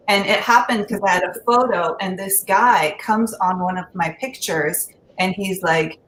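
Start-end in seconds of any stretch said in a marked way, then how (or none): none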